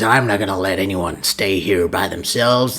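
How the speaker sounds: low, soft put-on voice; mock a forced voice, dropped deep and quiet; forced game-hero voice. Imitating V refusing to leave anyone, forced low voice. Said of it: "with that forced voice of his".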